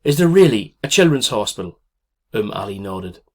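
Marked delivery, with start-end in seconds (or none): 0.0-1.8 desperate question. Acted. Frightened mother begs for hope.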